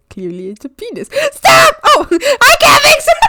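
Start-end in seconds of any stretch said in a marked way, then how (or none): 1.3-3.3 high loud laughing shout; laughing shriek. Real, not acted. Streamer laughing at her drawing, shrieking.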